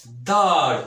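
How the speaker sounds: दाढ़ loud with a heavy breathy ढ़; heavy sound. Shown as a demonstration. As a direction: punch दाढ़ out heavily. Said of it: "original जो ख़ास आवाज़ है". (भारी आवाज़ में दाढ़)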